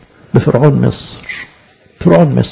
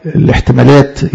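normal talking voice

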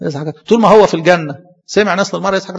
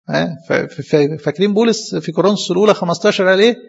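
ordinary talk, nothing marked

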